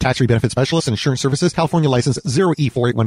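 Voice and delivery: rapid-fire legal disclaimer, loud; fast disclaimer. Acted. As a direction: rattle off the fine print.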